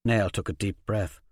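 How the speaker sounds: usual speaking voice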